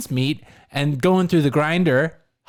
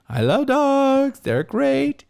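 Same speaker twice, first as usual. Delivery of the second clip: high, slow, put-on Queen voice; mock Queen voice; 10 semitones higher, much slower than usual. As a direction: voice the Queen cooing over the dogs. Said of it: "to be like".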